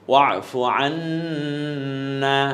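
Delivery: slow, stretched "আন্না" with the alif held; long alif drawn out. Shown as a demonstration. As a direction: draw out the final vowel of "আন্না" one alif. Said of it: "এক আলিফ টেনে বলবো".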